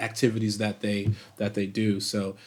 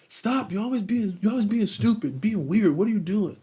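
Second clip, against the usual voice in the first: higher, whiny little brother voice; whiny kid brother; 9 semitones higher than usual. Retold or imitated. Kid brother complaining to his pestering sibling, higher whiny voice. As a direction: play the annoyed kid brother yelling stop. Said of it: "He was like".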